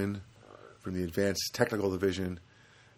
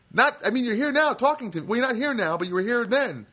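high, loud, strained rant; exasperated outburst; 13 semitones higher, 4 dB louder than usual. Retold or imitated. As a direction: lose it completely, pleading and yelling. Exasperated outburst at repeated questions.

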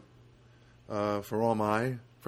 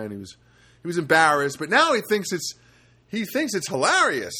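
ordinary talk, nothing marked

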